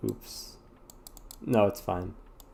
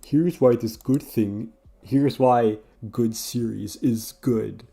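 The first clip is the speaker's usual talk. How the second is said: slow, drawn-out essay narrator voice; a pompous narrator, slowed right down; mock video essayist; 3 semitones higher, 5 dB louder, slower than usual. Mimicking a video essay's solemn intro, slow. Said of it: "video essays that are like".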